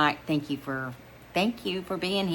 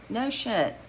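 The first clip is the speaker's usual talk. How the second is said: ordinary delivery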